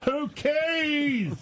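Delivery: very high, long-held holler; yelled call. Retold or imitated. Host correcting how the TSA call went, yelled call. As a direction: call it out high, stretching KEYS. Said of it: "yell out".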